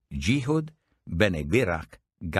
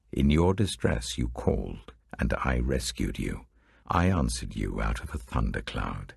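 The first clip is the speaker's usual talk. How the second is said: unknown voice; 8 semitones lower than usual. Acted. God warning his people Israel, in a lower voice. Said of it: "I heard an unknown voice say".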